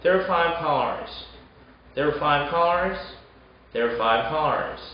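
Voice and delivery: "There are five cars." as "there five cars"; "There are five cars." with "are" swallowed; second R dropped. Shown as a demonstration. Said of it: "이렇게 R을 빼버리면".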